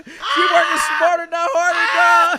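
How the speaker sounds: slow, high, loud proud-dad shout; stretched-out hype yell. Retold or imitated. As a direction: bellow it slowly like a bragging father. Proud dad shouting about daughter.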